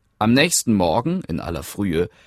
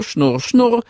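spinning wheel whirr; 5 dB louder than usual. Acted. Spinnrad-Geräusch nachgeahmt.

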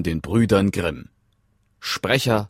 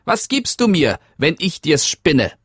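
little man; 10 semitones higher, 4 dB louder than usual. Acted. Männlein fragt nach Lohn.

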